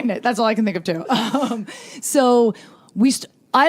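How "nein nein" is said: hammed-up desperate shouting, half laughing; mock panicked yell. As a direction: yell your only German word in comic desperation. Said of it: "I was like".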